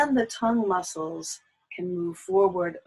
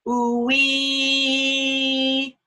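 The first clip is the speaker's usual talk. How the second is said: arched tongue ooh-wee; 4 semitones higher, 5 dB louder than usual. Shown as a demonstration. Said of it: "the tongue is arched".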